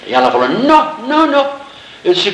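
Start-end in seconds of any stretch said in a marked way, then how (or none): 0.5-1.5 high, insistent retold no; emphatic refusal. Actress refusing to go to her husband, emphatic high no. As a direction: refuse in quick high-pitched repeats.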